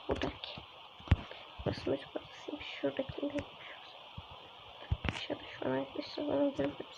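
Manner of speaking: quick hushed whisper to viewers. Real, not acted. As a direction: keep it to a breath so nothing hears. Hiding, monster nearby. (fısıldayarak)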